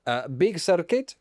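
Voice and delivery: put-on comic Italian accent; Stefano Domenicali. Boss introducing himself to the public, Italian accent. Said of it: "I'm Stefano Domenicali".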